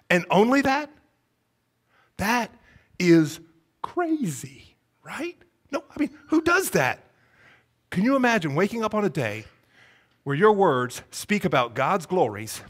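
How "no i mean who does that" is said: usual speaking voice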